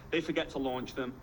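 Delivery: ordinary talk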